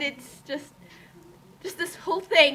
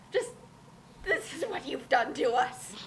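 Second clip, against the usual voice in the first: hushed whisper at the author; 5 dB quieter than usual. Acted. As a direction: breathe it out as an accusation. Mock-dramatic address to Torgo.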